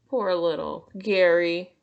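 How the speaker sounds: ordinary delivery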